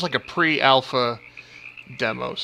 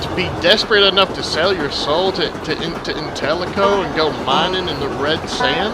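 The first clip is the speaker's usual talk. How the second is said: put-on southern accent. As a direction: lay the Southern accent on thick. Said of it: "exaggerate my already southern accent".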